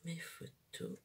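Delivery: whispering so no one wakes; hushed, barely voiced; library whisper. Real, not acted. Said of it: "je parle tout bas". (chuchotement de bibliothèque)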